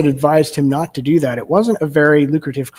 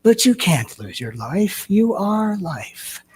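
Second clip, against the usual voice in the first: slow, soft, drawn-out imitation of a gentle teacher; Eckhart Tolle voice; 3 semitones higher, 4 dB quieter, slower than usual. Quoting a spiritual teacher to the congregation, slow, soft imitation. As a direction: stretch each word out slowly and quietly. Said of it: "That's what he said with that voice".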